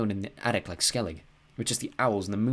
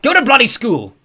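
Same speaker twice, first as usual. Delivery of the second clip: furious yell, much higher and louder; 17 dB louder than usual. A narrator's reading. Father yelling at his son in the kitchen. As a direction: snap it at him. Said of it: "he yelled".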